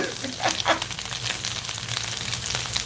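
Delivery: airy unvoiced giggle after singing; a soft laugh at the silly song; breathy laugh. Real, not acted. Laughing at own song while cooking, soft giggle.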